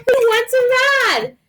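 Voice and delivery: high, cheery little truck voice; little blue truck. A narrator's reading. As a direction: call out an eager invitation in a high voice. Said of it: "said Blue".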